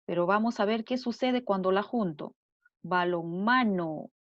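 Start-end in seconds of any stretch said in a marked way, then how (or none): 2.8-4.1 Balonmano said slowly, syllable by syllable, leaning on -ma-; slow syllable demo. Shown as a demonstration. Teacher showing pupils where the stress falls, slow and clear. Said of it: "¿Dónde lleva la mayor fuerza de voz?".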